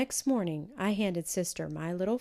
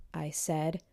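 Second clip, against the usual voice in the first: ordinary delivery, nothing marked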